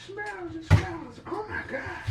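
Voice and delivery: high-pitched squeal of disgust over the onion; squealing at the smell. Real, not acted. Cook hit by a strong onion smell, high squeal. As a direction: squeak it out, shocked by the stink.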